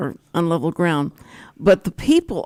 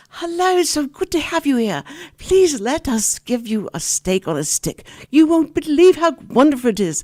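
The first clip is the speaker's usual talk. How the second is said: mock wench greeting; 7 semitones higher, 3 dB louder than usual. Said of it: "these two, um, wenches".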